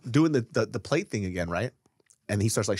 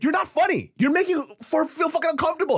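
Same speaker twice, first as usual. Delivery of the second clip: much higher, louder angry question; pissed-off challenge; 3 dB louder than usual. Retold or imitated. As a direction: confront him, fed up. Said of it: "I'm pissed".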